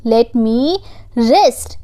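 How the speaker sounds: "let me rest" stretched out slowly; slow each word of "let me rest" for learners; slow repeat. Shown as a demonstration. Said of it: "slow repeat for learners".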